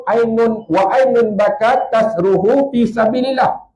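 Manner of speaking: slow, measured Arabic recitation; Arabic hadith recitation. Real, not acted. Preacher quotes the Prophet's words in Arabic, slowly.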